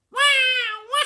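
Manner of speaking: put-on very high, loud baby cry; baby wailing. A narrator's reading. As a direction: bawl like a newborn. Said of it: "bawling her head off".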